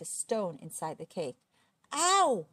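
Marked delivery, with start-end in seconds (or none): 1.9-2.5 loud, high yelp of pain; sharp storybook howl; yell of pain. A narrator's reading. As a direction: cry out in sudden pain. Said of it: "he yelled".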